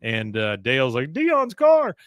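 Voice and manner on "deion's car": high, loud cry of dismay; acted outcry. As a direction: yelp in alarm for a beloved car. Dale cries out over tipped car.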